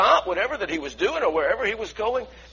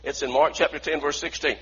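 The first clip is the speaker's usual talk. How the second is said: ordinary talk, nothing marked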